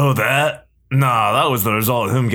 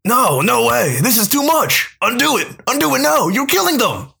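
high, frantic, pleading cries; panicked pleading; 13 semitones higher than usual. Acted. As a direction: beg them to stop it.

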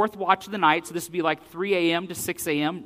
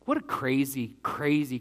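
ordinary talk, nothing marked

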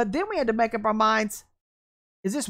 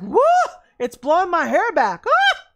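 high, louder hammed-up whoop; mock excited shout; 6 semitones higher, 7 dB louder than usual. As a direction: play the amazed churchgoer. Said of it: "everybody's like".

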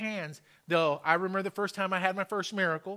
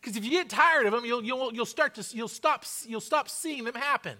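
raised, higher-pitched sermon voice; fervent preaching; 6 semitones higher, 5 dB louder than usual. Real, not acted. Preacher urging congregation to testify of miracles, fervent preaching. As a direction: drive it home to the congregation with force.

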